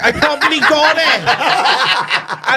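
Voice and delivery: slow high laughing mumble; mock meek reply. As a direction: squeak it out, laughing at yourself. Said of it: "I was like".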